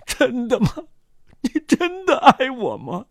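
high, tearful disbelief. A narrator's reading. Convict moved to tears by love, tearful. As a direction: ask it through tears, pitched high. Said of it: "那罪犯泪流满面".

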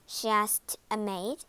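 usual speaking voice

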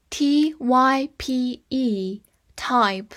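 letters one by one, very slow; spelled out slowly. Shown as a demonstration. Teacher spelling the word for students, slowly. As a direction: spell each letter carefully. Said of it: "spelling out letters".

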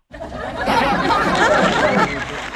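long falling burst of laughter. Real, not acted. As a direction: laugh hard at your own joke. Host laughs at 瞎跳. (哈哈大笑)

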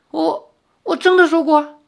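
high, stammering question; falter, suddenly unsure of yourself; flustered guilty doubt. Acted. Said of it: "有点心虚起来".

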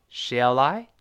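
"Shall I" linked, L flowing into I. Shown as a demonstration. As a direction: join "Shall I" smoothly as one word. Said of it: "我们连起来". ("Shall I" 连读)

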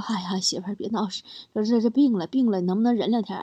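soft, low feverish pleading; weak and listless. Retold or imitated. Sick husband begging his wife to wait, weak and listless. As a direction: act drained of all strength, voice dropped. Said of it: "我有气无力地说".

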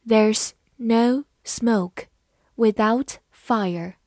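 slow, separated words; deliberate teacher's reading with pauses; slow model reading. Shown as a demonstration. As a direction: take the proverb slowly, word by word. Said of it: "慢慢来读".

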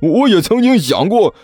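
惊慌失措，音调高高吊起; 像被吓坏了一样，尖着嗓子连连感叹; 吓了一跳. A narrator's reading. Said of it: "张野飞更是吓了一跳". (又惊又怕，高声慌张地说)

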